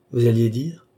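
mildly threatening question; menacing exclamation. A narrator's reading. Philippe, with a threatening gesture, cuts in on Balsamo menacingly. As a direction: challenge him to finish. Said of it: "s'écria Philippe avec un geste menaçant".

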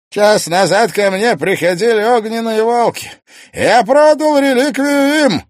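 high, loud gloating villain; theatrical villain. Acted. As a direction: relish every cruel word. Toymaster taunts Platon.

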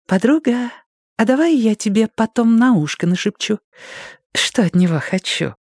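high, sly conspiratorial tease; sly teasing woman. A narrator's reading. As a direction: lure her in with a secret.

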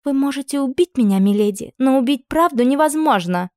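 high, theatrical exclamation; hammy stage actor. A narrator's reading. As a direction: declaim it like a tragic heroine. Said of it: "пафосно воскликнула она".

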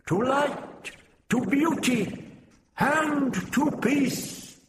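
high, ringing, stately plea; Vrestin's noble appeal. A narrator's reading. Vrestin appeals to the captors in a ringing voice. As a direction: rouse the captors to their lost glory.

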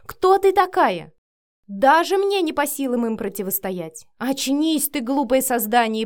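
loud, high, furious tirade; angry explosion. A narrator's reading. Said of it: "взорвался Матвей". (гневно, на повышенных тонах)

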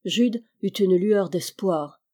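plain reading voice

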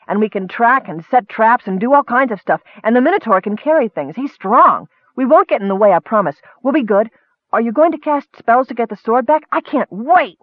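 high-pitched, eager rush of promises; excited kender. A narrator's reading. Kender begging to join the sword hunt, excited. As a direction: bubble over like an overkeen child.

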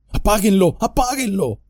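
high, begging plea; pleading. Acted. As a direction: beg them desperately to stop. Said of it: "suplicó Merry".

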